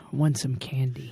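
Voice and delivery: slowing into a low whisper; hushed mimicry. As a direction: whisper it as the candy thief. Mimicking the candy thief.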